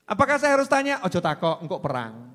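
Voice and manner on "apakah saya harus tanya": imitated questioner. Voices the questioner's follow-up.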